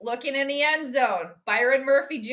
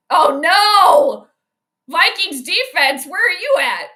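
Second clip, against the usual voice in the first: higher and louder, dragged-out cry at the defense; frustrated shout; 6 semitones higher, 9 dB louder, slower than usual. Real, not acted. Vikings fan streamer shouts at her own defense, loud and exasperated. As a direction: yell at the team to show up.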